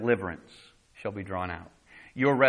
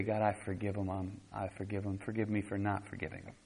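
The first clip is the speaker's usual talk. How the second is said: low, soft prayer of forgiveness; humble prayer; 4 semitones lower, 10 dB quieter than usual. Acted. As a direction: confess it softly to God. Models humble private prayer.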